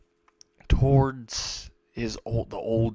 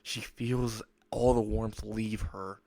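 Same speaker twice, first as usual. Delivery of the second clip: ordinary delivery, nothing marked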